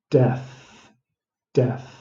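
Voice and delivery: "death, death" said over and over, exaggerated; repeated death. Shown as a demonstration. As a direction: drill death again and again. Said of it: "I might exaggerate it. I might say it ten times".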